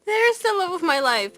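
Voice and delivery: high, loud lovestruck gush; swooning. Retold or imitated. Mocking Erina as she spots her husband, lovestruck and high. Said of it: "And she's like".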